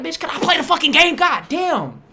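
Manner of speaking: high, loud exasperated snap; annoyed yelling. Retold or imitated. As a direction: yell it like you're fed up. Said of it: "I'm like".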